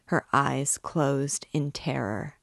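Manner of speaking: ordinary delivery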